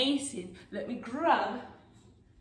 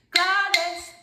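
higher call-out for copying; 4 semitones higher, 4 dB louder than usual. Shown as a demonstration. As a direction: call the name out brightly in time. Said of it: "as you call the name claves".

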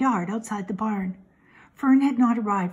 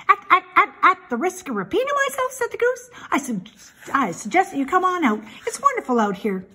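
high, quick goose voice repeating syllables; stammering goose; 10 semitones higher, 4 dB louder, faster than usual. A narrator's reading. Goose coaxes Wilbur to come out, high and quick. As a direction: cluck the words out fast and high.

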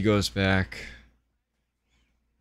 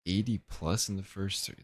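normal talking voice

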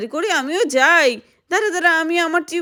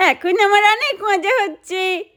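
high laugh, then words still full of it; a child laughing, finally happy on the strawberry float; laughing happily; 5 semitones higher than usual. Acted. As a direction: giggle through the joy.

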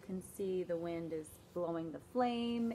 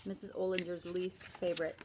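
ordinary delivery

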